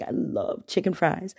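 ordinary delivery

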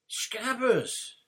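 high startled cry of a name; surprised shout. Acted. Ron startled by rat.

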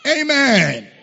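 rally the congregation into a roar of praise; full-force preaching, hyping up the crowd; rousing preacher. Real, not acted.

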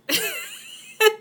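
high, loud cackling laugh; sudden high laugh; burst of laughter. Real, not acted. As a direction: crack up out loud at the joke. Player cracks up at the joke.

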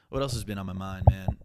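frustrated loud groan-word; loud outburst. Real, not acted. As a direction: let the man burst out.

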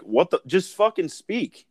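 high, slow exasperated plea. Retold or imitated. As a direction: beg him to get to the point, drawn out. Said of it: "and you're like".

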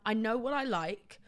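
ordinary talk, nothing marked

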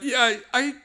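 high, guilty, stammering confession; sheepish confession. Retold or imitated. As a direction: squeak out the guilty admission. Woody confessing to his friends.

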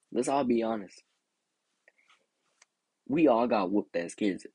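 ordinary talk

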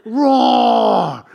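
loud roar like a little lion; kid roaring. Retold or imitated. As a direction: roar it out as a kid playing a bear. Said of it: "he would go".

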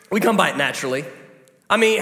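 usual speaking voice

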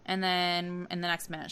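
usual speaking voice

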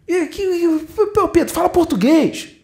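high, put-upon complaint; exasperated plea. Retold or imitated. Man pleading with Pedro to talk simply, high and exasperated. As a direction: beg him to speak plainly. Man begs plain words.

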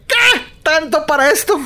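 high-pitched incredulous reaction, mock-appalled; retold disbelief. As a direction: imitate someone exclaiming in disbelief. Acts sister's reaction to ending.

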